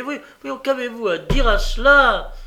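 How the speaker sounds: very high, defiant challenge growing loud. A narrator's reading. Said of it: "sur un ton très élevé".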